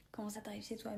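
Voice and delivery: quick whispered mock question; whispering. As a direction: whisper it fast, as a puzzled viewer. Imagined viewer question. (chuchotement rapide, faussement étonné)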